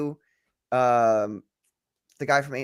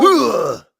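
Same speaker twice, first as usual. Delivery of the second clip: pained grunt; 6 semitones higher, 10 dB louder than usual. Acted. Hit by bonk.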